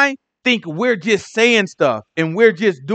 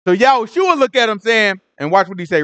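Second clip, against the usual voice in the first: ordinary talk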